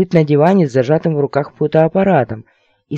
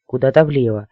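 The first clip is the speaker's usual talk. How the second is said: plain reading voice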